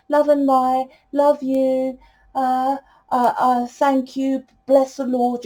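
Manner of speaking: high, droning love-and-light chant; spiritual monotone. Retold or imitated. Reader showing how healers talk, mock pious monotone. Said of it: "that energetic frequency of that monotone".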